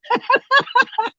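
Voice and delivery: sudden high-pitched laugh; squealing real laugh. Real, not acted. Laughing at own anger story.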